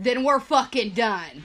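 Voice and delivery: loud, slow, defiant comeback; defiant retort. Retold or imitated. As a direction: throw it back at him, hitting each word. Throws his ultimatum back at him.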